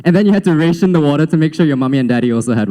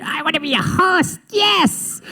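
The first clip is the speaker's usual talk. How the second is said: high squeaky mock kid voice; imitating an overexcited child, far higher than usual; eager little kid; 13 semitones higher, 4 dB quieter, slower than usual. Speaker mocking a kid dreaming of being a host, high and gleeful.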